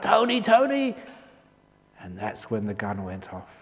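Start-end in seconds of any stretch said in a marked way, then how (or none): 0.0-1.0 high cry of a name; calling out. Retold or imitated. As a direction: call out to stop him. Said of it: "shouted out".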